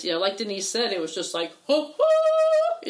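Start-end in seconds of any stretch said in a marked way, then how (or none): 1.7-2.9 high loud hooting whoop; joyful hoots; whooping cheer. Retold or imitated. Celebrating getting together.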